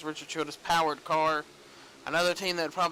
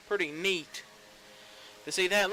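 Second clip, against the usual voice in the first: normal talking voice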